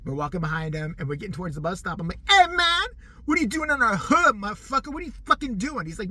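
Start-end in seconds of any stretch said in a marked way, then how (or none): 2.3-5.8 loud hammed-up tough-guy yelling; shouted mock street threat; mock gang threat. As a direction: bark it like a fake gangster picking a fight. Mock gang confrontation at bus stop.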